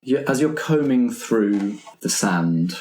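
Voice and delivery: ordinary delivery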